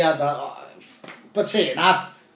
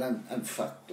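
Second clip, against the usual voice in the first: exhausted; 4 semitones lower, 13 dB quieter, slower than usual. Retold or imitated. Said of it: "I remember thinking".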